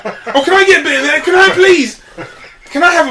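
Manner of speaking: high, loud, laughing whiny begging; mock greedy friend pleading for a bite; mocking entitled mate. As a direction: whine for a share like a greedy mate through laughter. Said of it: "that entitlement".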